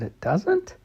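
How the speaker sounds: high surprised question. Acted. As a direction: sound taken aback. Surprised child.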